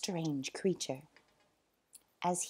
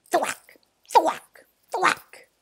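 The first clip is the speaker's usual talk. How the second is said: loud, rising thwacks; punchy sound effects; 10 semitones higher, 8 dB louder, much slower than usual. A narrator's reading. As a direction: smack each word out.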